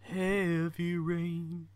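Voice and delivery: "Heavy Rain" stretched very slowly, each syllable long; drawn-out. Shown as a demonstration. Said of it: "I have to do that in my head".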